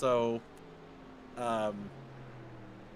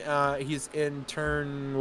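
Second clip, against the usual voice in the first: usual speaking voice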